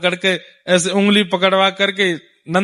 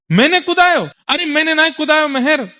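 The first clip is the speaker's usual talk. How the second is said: indignant disbelief; 8 semitones higher than usual. Acted. Nand Baba protesting innocence.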